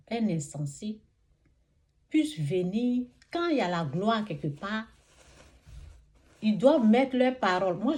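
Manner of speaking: rising, emphatic sermon voice; fervent preaching. Acted. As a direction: preach it out with conviction.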